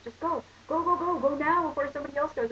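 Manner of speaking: high, eager push to go now; mock classmate hurrying her up to the board; urging classmate. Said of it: "And then he's like".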